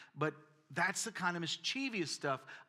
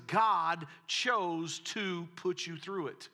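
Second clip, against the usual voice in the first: slow, louder heavy stress; drawn-out emphasis; 4 semitones higher, 6 dB louder, much slower than usual. Acted. Preacher mocking the fatalistic teaching he grew up with, slow and heavy. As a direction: lean on every word slowly.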